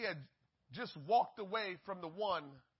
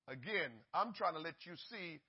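normal talking voice

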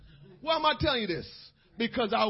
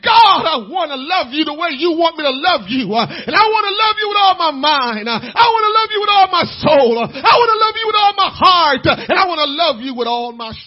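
louder, higher, building cry of devotion; loud prayer; 5 semitones higher, 12 dB louder than usual. Pretend. Pastor voicing the prayer the congregation should pray, loud and rising. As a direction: pour it out to God at full voice. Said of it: "You need to say".

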